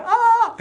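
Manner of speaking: very high, loud laughing "ah"; big-smile "ah". Shown as a demonstration. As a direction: beam and squeal it out. Demonstrates a big cheese smile.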